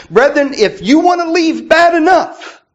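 high, loud preacher's cry; preaching shout. Real, not acted. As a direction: call it out to the congregation. Preacher raising voice to congregation.

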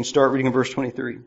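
ordinary talk

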